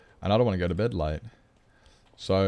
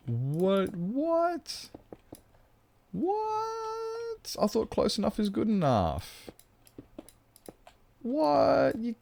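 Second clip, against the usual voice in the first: high squeaky mock outrage, much higher; squeaky what; much slower than usual. Streamer squeaks back at chat in high mock protest. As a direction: squeal it like a cartoon.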